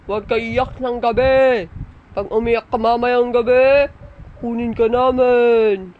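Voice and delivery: lightly voiced warning from a pretend caller; mock stern caller warning. Pretend caller warns child. (kunwaring babala ng estranghero)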